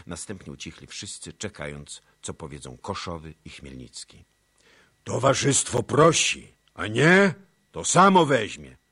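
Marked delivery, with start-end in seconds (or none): 5.0-8.8 high, loud ultimatum; threatening demand. A narrator's reading. Deputies threatening the elders, loud ultimatum. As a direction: warn them they'll take him. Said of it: "powtórzyli deputaci".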